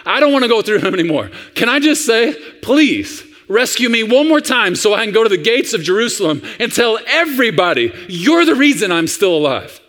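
imitating an earnest kid; pleading little boy. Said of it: "And the little boy says".

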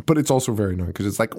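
ordinary delivery

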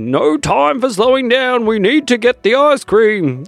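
racing after the van; eager shout. Acted. Horse chasing the van.

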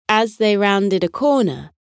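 ordinary delivery, nothing marked

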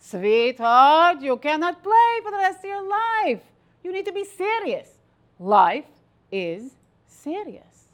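loud, high scolding Ukrainian mother; Ukrainian accent. Retold or imitated. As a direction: lay down the law in a Ukrainian accent, slowing at the end. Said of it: "said in her beautiful Ukrainian accent".

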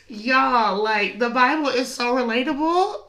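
ordinary talk

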